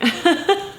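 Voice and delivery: high, embarrassed laugh through tears; real laugh while crying; tearful laugh. Real, not acted. Vlogger laughing at herself for crying, tearful. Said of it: "I don't know why I'm crying".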